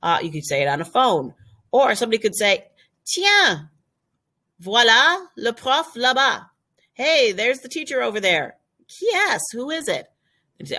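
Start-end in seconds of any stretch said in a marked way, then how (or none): 3.1-8.6 excited pointing out. Pretend. Pointing out the teacher. 8.9-10.1 curious question. Pretend. Asking who someone is.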